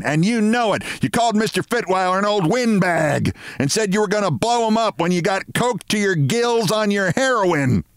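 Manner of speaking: high-pitched, bawled accusation; bawling. A narrator's reading. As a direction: bawl the charge at the culprit. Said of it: "she bawled at Mister Martin".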